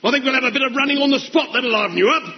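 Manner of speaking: normal talking voice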